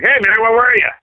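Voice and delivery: loud, high imitation of a friend's greeting; calling out. Rock star to singer at rehearsal door, loud calling out. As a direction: holler it eagerly across to him.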